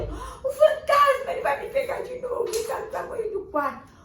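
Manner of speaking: high, loud, crying panic; terrified child. Acted. Boy sobs to his dad that the ghost will catch him. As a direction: sob out the fear to dad.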